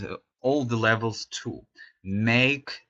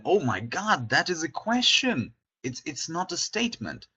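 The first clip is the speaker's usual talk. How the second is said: pitched up high, a sudden mock realization; mock surprise; 6 semitones higher than usual. Student realizing the tag makes it a question, mock surprised. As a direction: play the student who suddenly gets it.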